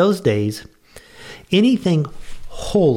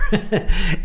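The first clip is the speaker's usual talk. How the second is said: real chuckle; 8 semitones higher than usual. Real, not acted. Laughs at his own joke.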